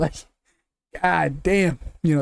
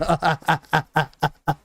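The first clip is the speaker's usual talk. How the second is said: real laugh. Real, not acted. Laughing at old title defenses.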